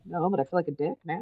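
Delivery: low, fast, laughing husband voice; mock the husband's embarrassment; sheepish husband. Husband admits the roses weren't from him, low and quick.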